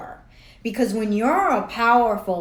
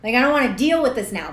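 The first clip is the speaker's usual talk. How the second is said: higher, louder exasperated groan of a quote; fed-up outburst at her own thoughts; 6 semitones higher, 6 dB louder than usual. Retold or imitated. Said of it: "And I'm like".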